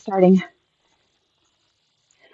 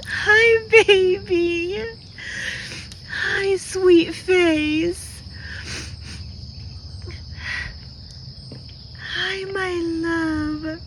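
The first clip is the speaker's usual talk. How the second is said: much higher and slow, tender relief; tender high cooing; 9 semitones higher, 6 dB quieter, much slower than usual. Real, not acted. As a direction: murmur love to the pet, slowly. Tender reunion with lost pet.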